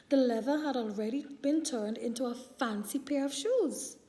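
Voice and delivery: plain reading voice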